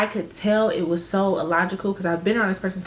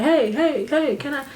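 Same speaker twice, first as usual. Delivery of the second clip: higher-pitched, mild imitation of hollering; mock yell; 5 semitones higher than usual. Imitating a man hailing women at night, mock mild yell. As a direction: call out "hey" like a stranger, without real volume. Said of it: "somebody to be yelling at you like".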